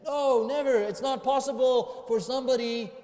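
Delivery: high, loud, vehement denial; preacher's forceful exclamation; most emphatic refusal. Acted. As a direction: thunder it out as utterly impossible. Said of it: "can't say it any more emphatically".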